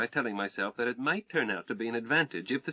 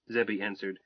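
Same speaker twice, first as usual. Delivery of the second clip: usual speaking voice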